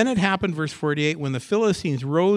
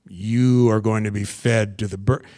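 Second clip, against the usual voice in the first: low giant's threat, louder; Goliath voice; 4 semitones lower, 6 dB louder than usual. Retold or imitated. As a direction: growl it down like a hulking bully. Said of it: "So you hear Goliath".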